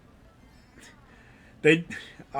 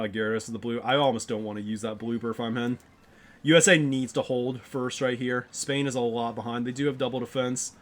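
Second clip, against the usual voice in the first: ordinary delivery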